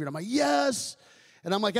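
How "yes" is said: high, emphatic yes; retold fervent yes. Man retells accepting Jesus before the chaplain, fervent. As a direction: blurt out a wholehearted yes, retelling it.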